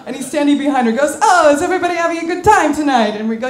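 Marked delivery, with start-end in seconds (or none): 1.2-3.1 high, loud host voice, imitated; jovial maitre d'. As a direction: play a maitre d' greeting the whole table. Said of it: "the maitre d' of the cruise comes over".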